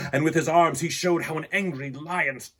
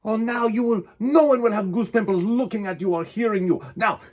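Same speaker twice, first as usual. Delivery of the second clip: higher, louder scolding showman; exasperated ringmaster; 9 semitones higher, 5 dB louder than usual. A narrator's reading. Circus owner scolding the gentle lion, high and loud. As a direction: chide him like a fussy trainer.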